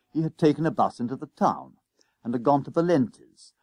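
usual speaking voice

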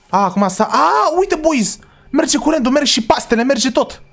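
high, bright burst of relief; cheering as the power comes back; excited cheer. Real, not acted. Cheering to the viewers as the lights return, excited.

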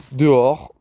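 plain reading voice

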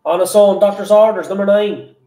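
loud sing-song bingo calls; bingo caller. Acted. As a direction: call the numbers like a bingo hall. Bingo number calling.

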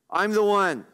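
firm, declarative "I'm the one"; emphatic. Acted. Preacher paraphrasing the Lord's self-introduction, emphatic. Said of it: "This is emphatic".

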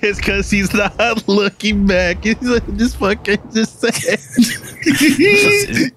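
high, laughing mimic; laughing imitation. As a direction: giggle through the impression.

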